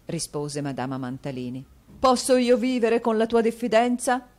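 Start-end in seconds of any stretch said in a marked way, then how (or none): 2.0-4.3 loud, higher theatrical anguish; anguished exclamation. A narrator's reading. Husband to wife, loud anguish. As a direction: wail the question. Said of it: "esclamò il marito".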